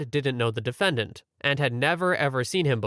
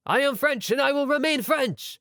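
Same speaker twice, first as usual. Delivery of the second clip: shouted defiance; 11 semitones higher than usual. Retold or imitated. Condemned marshal defying the court, shouting. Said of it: "shouted out to the court".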